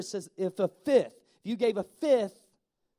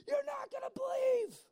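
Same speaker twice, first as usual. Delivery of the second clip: much higher, breathless news; telling a friend big news, much higher but softer; excited squeal; 7 dB quieter than usual. Pretend. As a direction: squeal it into the phone. Retold excited phone call.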